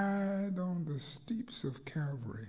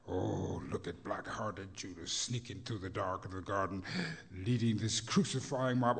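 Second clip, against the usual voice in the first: chanting sermon with swelling peaks; preached poem; 11 dB louder than usual. Acted. Rhythmic preached poem throughout.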